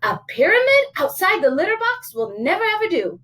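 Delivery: high, swooping motherly disapproval; high storybook mom laying down rules; scolding mama. Acted. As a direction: forbid it like a fussy mother. Mama scolds her son in story.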